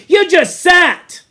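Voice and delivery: slow, loud, high jeer; contemptuous. Acted. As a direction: drag out the scorn. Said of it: "said Jack contemptuously".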